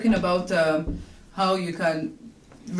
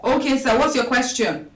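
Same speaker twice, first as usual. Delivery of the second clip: to someone hard of hearing; 6 semitones higher, 5 dB louder than usual. Real, not acted. Caller on a bad phone line.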